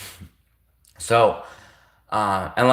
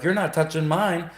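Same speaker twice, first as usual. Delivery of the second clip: higher, lightly voiced indignant soldier; a hint of a soldier recoiling, pitched up; mock soldier quote; 7 semitones higher than usual. Soldier refusing the crazy stranger, mock high protest. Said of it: "soldiers would be like".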